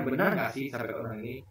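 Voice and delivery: low, hushed musing to oneself; imagined pondering. Retold or imitated. Criticised leader weighing whether the critic is right, imagined quiet pondering. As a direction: wonder softly, as if thinking it through. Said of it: "bermenung, memikirkan".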